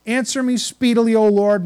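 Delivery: fervent loud plea; loud prayerful plea. Acted. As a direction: call out to God, urgent. Preacher crying out prayer.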